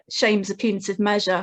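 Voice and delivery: ordinary delivery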